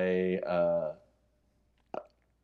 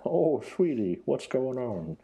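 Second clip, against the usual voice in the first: put-on old man voice; 6 semitones higher, slower than usual. Imitating Lady Rainicorn as old man.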